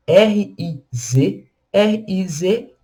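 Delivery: R I Z letter by letter, slow; spelling out slowly. Shown as a demonstration. Teacher spelling the word for students, slow letters. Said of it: "a gente tem a palavra riz".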